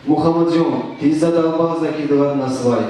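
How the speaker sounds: normal talking voice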